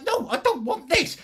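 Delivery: high, whiny mock protest. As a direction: squeal a pretend refusal. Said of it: "And I'm like".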